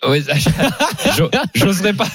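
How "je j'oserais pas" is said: high giggle around the words; laughing. Real, not acted. Host jokes with the caller about their matching haircut, laughing. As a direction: laugh it off, embarrassed.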